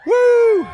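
high, loud playful whoop; joking whoop. Acted. Preacher whooping at his own hands-up question, high and loud. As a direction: cheer like someone won a prize.

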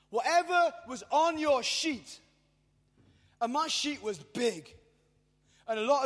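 high, loud proclamation of full payment; full-force preacher's cry; preaching at full force. Acted. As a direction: shout it out like the climax of the sermon.

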